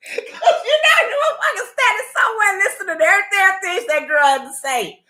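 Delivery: high, laughing through the words; can barely get it out for laughing; words squeezed out between real laughs; laughing hard. Real, not acted. Laughing about eavesdropper.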